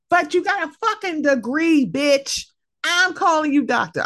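high, mock-outraged insistence; emphatic insistence. Insisting to her friend on using the title, high playful protest.

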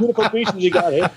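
high, hammed-up Australian accent; weird Australian accent. Tourist bluffing police to dodge questions, fake Australian accent. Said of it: "weird Australian accent".

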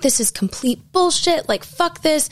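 high, restrained mock yell; retold angry yelling. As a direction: imitate a furious guest without raising the volume. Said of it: "he's just yelling at me".

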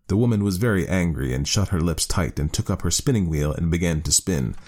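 ordinary delivery, nothing marked

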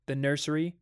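ordinary delivery

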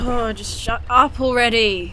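groggy, snapping at the noise to stop; annoyed outburst. Acted. Yelling at a noise, waking.

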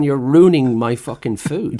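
slow, loud, exasperated complaint; hammed-up indignant chef; mock outrage. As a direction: let the fury leak out through slow, heavy words. Chef's silent reaction to diners.

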